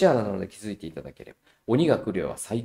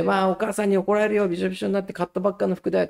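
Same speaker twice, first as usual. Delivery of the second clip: higher, hurried playful scold; mock warning; 8 semitones higher, faster than usual. Father teasing daughter in the squall, playful high warning. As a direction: tease her about mom's anger, quick and high.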